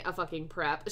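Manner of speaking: usual speaking voice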